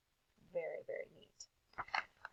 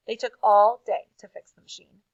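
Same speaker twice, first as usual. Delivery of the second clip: plain reading voice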